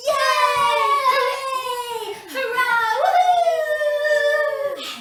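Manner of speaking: high, loud whooping cheers; crowd cheer. Shown as a demonstration. Presenter demonstrating a crowd cheer for the kids, loud whoops. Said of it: "We're gonna cheer like this".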